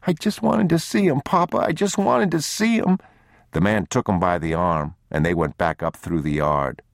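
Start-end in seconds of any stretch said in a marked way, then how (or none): 0.0-3.0 put-on high, pleading child's wail; tearful little boy. A narrator's reading. Boy dragged away by his father, high tearful pleading. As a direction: plead with Papa through tears. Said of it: "The boy wouldn't stop crying".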